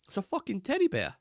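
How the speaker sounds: high-pitched mock Boston accent; Mark Wahlberg. Streamer quoting a film to a Boston viewer, mock Boston accent. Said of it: "Do you speak like that".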